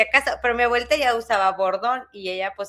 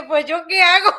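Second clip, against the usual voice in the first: retold panic; 7 semitones higher, 8 dB louder than usual. Retold panic at being left alone.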